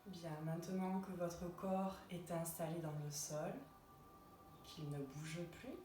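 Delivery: unhurried relaxation voice with long pauses; guided meditation. Acted. Yoga teacher guiding listeners lying in Shavasana, calm guided meditation. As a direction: guide them gently into rest, phrase by phrase.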